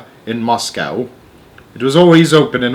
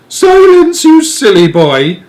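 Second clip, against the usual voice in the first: very high, loud scolding nanny; stern old nanny; 10 dB louder, slower than usual. Acted. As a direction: silence a naughty boy like a fierce old nurse. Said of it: "said Missus Tibbs".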